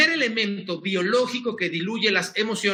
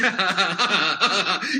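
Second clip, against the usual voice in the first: demo laugh. Shown as a demonstration. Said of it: "¿cómo te ríes?".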